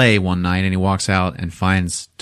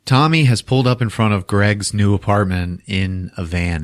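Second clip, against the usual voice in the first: normal talking voice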